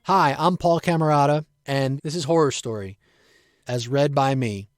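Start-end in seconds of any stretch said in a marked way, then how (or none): none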